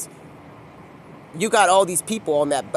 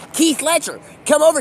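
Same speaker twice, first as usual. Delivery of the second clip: higher-pitched call to someone across the way; 7 semitones higher, 3 dB louder than usual. Acted. Calling Keith Ledger over to talk, calling out. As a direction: hail him over, voice raised.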